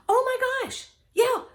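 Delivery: high, mock-scandalised gasp; shocked. Real, not acted. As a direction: act appalled at the idea. Shocked at Food Network habits.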